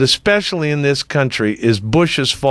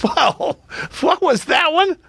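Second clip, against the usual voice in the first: can't believe what was just read; stunned disbelief; 13 semitones higher, slower than usual. Real, not acted. Host stunned by a comment wishing Karl Rove harm, high disbelief.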